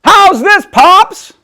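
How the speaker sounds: put-on high, loud, eager girl; loud child voice. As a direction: still booming when told to hush. Loud girl asked to be quieter.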